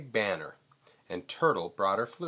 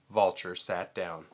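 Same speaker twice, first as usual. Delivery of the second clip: ordinary delivery, nothing marked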